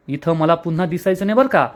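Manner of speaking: usual speaking voice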